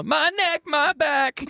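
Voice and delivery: put-on high strained old man voice; creaky old man groan. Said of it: "I'll be like".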